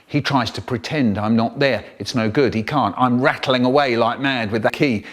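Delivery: usual speaking voice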